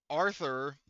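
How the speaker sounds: ordinary talk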